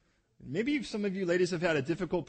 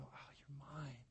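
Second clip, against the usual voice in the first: slow, much softer, hushed and tender; new father hushing over his newborn daughter; 18 dB quieter than usual. Retold or imitated. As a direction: breathe it to a baby in your arms. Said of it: "look at a child and go".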